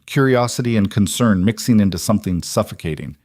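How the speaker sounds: plain reading voice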